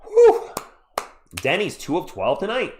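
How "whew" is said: high, loud exhale of relief; sharp high whew of relief; relieved whew. Real, not acted. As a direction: blow out a big whew. Tense free throw moment.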